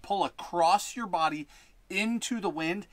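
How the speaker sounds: normal talking voice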